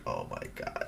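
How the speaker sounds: hushed, voiceless imitation of embarrassment; embarrassed. Imitating the dad shown his kid stealing on camera, embarrassed hush. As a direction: mimic an embarrassed dad under your breath. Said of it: "he was all embarrassed".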